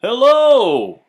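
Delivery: big high sing-song hello; cheery stranger. Acted. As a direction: greet the boy like an eager showman. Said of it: "said the man".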